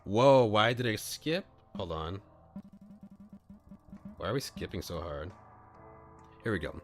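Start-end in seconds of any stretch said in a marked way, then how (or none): none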